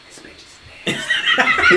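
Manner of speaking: hushed, gossipy whisper; doctor whispering to the nurse with the phone on mute; whispered aside. Retold or imitated. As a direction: whisper it so the caller can't hear.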